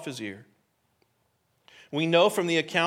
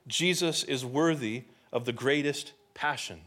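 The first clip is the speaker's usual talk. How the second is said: deliberate, spaced-out repeat of a key point; 3 semitones lower, 4 dB quieter than usual. Real, not acted. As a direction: let each phrase land separately. Main point repeated for emphasis.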